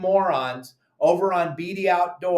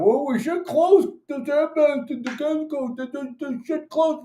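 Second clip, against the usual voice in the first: much higher, whiny mocking tone; 10 semitones higher than usual. Mocking the BD Outdoors posters in a high whiny voice. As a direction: parody the forum posters' whine.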